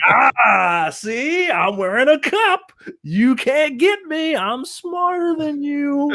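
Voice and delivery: high, drawn-out taunting voice; mock gloating. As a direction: gloat in a silly high drawl, stretching the words. Mocking wrestler's imagined gloating quote.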